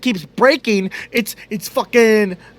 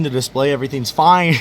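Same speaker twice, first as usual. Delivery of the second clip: ordinary delivery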